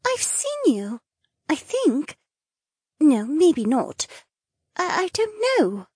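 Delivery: high, hesitant and unsure; falter, lose the thought; confused young woman. Acted.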